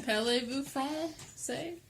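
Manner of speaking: slow, soft mock French; mock French accent. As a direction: ask it like a hammy Frenchman. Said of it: "mock French question".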